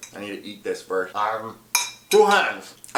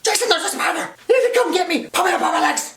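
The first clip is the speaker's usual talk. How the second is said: very high, squeaky mock panic; panicked; 6 dB louder, faster than usual. Trapped kid begging friend to pull him out, squeaky panic. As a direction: squeal frantically for rescue. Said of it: "he can tell I'm panicked now".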